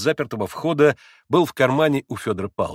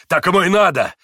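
loud, raised, heated retort; higher, vehement cry; breathless exclamation; 6 semitones higher, 6 dB louder than usual. A narrator's reading. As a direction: spit back defiantly, worked up. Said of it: "задыхаясь, воскликнул Дмитрий".

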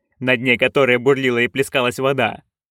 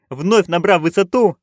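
ordinary delivery

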